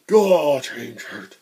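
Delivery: loud, high delighted "Oh"; excited. Real, not acted. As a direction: greet the loot with joy. Spotting loot.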